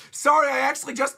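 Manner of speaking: high, loud acted blurt; hammed-up hasty shout; blurted confession. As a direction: burst out before being stopped. Luffy blurts, muffled by Usopp.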